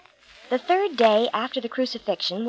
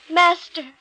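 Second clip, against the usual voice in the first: a higher joyful cry of recognition; joyous cry; 6 semitones higher than usual. A narrator's reading. Mary throwing herself at Jesus' feet, joyful cry. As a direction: burst out with joy at recognizing him. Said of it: "she joyously cried out".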